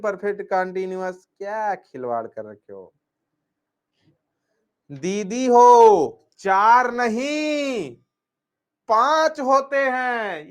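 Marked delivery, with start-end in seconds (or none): 4.9-10.5 slow and much louder, drawn out; forceful correction. Real, not acted. Teacher correcting students who said four, slow loud stress. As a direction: hammer home that it is five.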